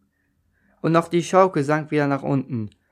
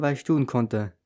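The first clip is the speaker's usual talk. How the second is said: ordinary delivery, nothing marked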